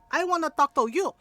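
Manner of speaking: confrontational. Retold or imitated. Aunt confronting the doctor, retold confrontational demand. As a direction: act out demanding a word with him.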